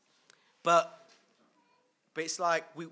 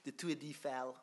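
an attempt at a French accent; 4 dB quieter than usual. Shown as a demonstration. Quizzing the audience on a landmark, attempted French accent. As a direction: say it in your best amateur French accent. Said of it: "in my best French accent".

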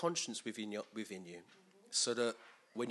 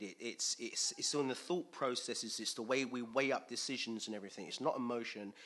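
usual speaking voice

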